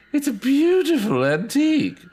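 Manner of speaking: drawn in by the doll, strangely; eerie delighted lady. Acted. Danita entranced by doll.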